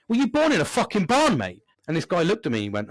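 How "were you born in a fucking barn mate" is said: much higher, louder irritated call-out; shouting at the man who left the door open, irritated; snapping at a stranger. Retold or imitated. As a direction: bark it across the café. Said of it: "I literally went".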